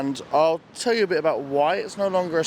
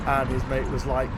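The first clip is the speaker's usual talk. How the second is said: normal talking voice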